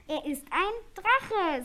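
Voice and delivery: "Er ist ein Drache" slow, high and loud; emphatic. Acted. Unicorn corrects her forgetful friend, emphatic "Er ist ein Drache".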